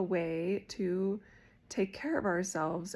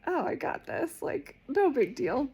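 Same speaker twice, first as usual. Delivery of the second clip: lightly cocky, higher-pitched self-quote; breezy confidence; 7 semitones higher, 4 dB louder than usual. Retold or imitated. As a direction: toss it off as no big deal. Retold confident self before the test.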